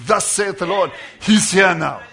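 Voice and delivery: a loud, ringing prophetic declaration; booming proclamation breaking into a laugh; proclaiming aloud. Retold or imitated. Prophet proclaiming in retold story.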